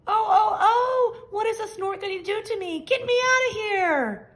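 high, loud panicked wail; scared baby bird. A narrator's reading. Baby bird carried off by the snort, high and panicked. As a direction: cry out in fear.

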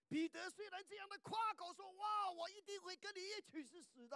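high, proud boast; boastful. Retold or imitated. Preacher voices Peter boasting loyalty, high and proud. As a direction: brag it like Peter.

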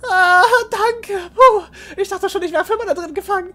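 put-on high-pitched kid voice, relieved; high child voice.

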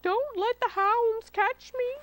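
high, slow, wailing plea; frightened cry. A narrator's reading. Fox fears the hunting dogs, crying out. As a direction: wail it out in dread. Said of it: "cried the fox".

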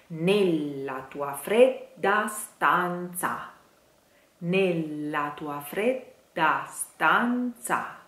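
slow, every A of stanza opened; slow model phrase. Shown as a demonstration. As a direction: linger on each A. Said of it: "lots of A".

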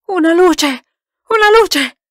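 loud, high cry for light; urgent high-pitched yell; shrieked cry. A narrator's reading. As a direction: shriek it out in alarm. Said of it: "strillò qualcuno".

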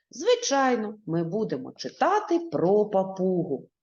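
ordinary talk, nothing marked

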